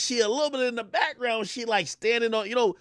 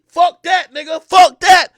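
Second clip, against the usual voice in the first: loud, raised-pitch refusal; indignant outburst; 9 semitones higher, 14 dB louder, slower than usual. Retold or imitated. Man refusing to be played, indignant outburst. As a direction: punch it out, high and loud.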